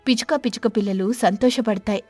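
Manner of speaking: usual speaking voice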